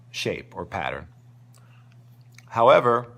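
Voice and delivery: normal talking voice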